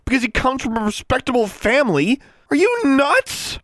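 very high, loud tirade; shrieked indignant questions. A narrator's reading. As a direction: rant at him in disbelief. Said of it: "lower your voice".

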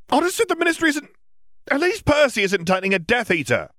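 high, fast heated near-shout; close to shouting. Acted. Ron arguing with Ginny about Percy, nearly shouting. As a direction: snap back at your sister, almost yelling. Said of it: "close to shouting".

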